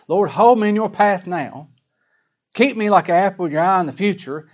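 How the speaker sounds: loud, high-pitched petition to the Lord; fervent prayer. Acted. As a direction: pray it out with urgent force.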